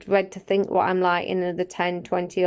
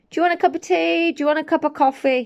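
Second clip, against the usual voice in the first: higher, quick call from the kitchen; mock call to husband; 6 semitones higher, faster than usual. Wife calling to husband in the next room, high quick call. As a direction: toss the question out fast and high across the house. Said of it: "shout to my husband".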